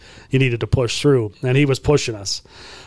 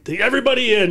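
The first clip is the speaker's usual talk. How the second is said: calling the team in; 9 semitones higher, faster than usual. Retold or imitated. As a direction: summon the whole team. Coach calls team in again.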